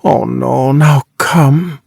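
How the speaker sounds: dreamy, sensual drawn-out words; slow, dragging. A narrator's reading. Dreamy woman protesting to her husband, slow and dragging. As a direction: drag each word out languidly. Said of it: "says in a slow, dragging voice".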